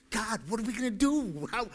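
high, mock-desperate appeal; imitated helpless cry to God; panicked plea. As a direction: turn and plead upward in alarm. Moses voiced turning round to God.

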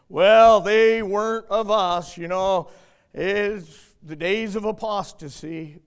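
loud, drawn-out mock excuse; hammed-up, booming excuse; mock excuse-making. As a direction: parody a dismissive churchgoer, heavily and slowly. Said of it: "We make excuses".

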